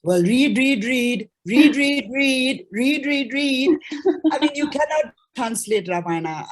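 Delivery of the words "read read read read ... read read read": "read" hammered out over and over, rising higher; insistent repetition. Real, not acted. Advising aspiring translators, insistent on "read". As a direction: drum in each "read" with growing force.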